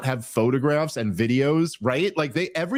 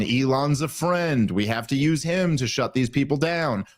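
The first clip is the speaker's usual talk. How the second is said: usual speaking voice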